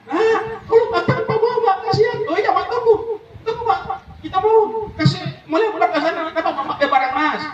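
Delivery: shrill fussing over belongings; high squeaky comic voices. Acted. Flood victims fuss in high squeaky voices over their things. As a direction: play the panicking family in a flood.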